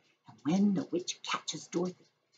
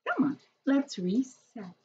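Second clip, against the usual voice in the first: slow, higher mom voice; Mom's voice; 5 semitones higher than usual. Acted. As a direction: read Mom's line slowly, pitched up. Mom voiced in read story.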